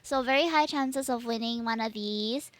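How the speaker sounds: normal talking voice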